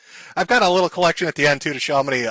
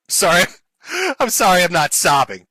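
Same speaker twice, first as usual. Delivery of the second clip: laughing through a fake apology; higher, louder insincere sorry breaking into laughter; mock apology; 4 semitones higher, 3 dB louder than usual. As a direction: apologize mockingly, unable to keep from laughing.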